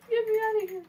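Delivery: very high squeaky pet voice; mock fleeing bird. Owner voicing Whitey wanting out, mock panic. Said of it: "She's like".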